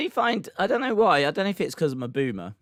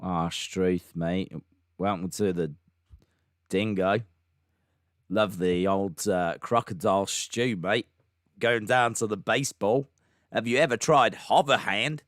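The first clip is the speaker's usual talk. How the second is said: hesitant, low Australian-accent attempt at Boston; badly faked Australian; 4 semitones lower than usual. Shown as a demonstration. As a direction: put on a poor Aussie accent, haltingly. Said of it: "do a bad Australian accent".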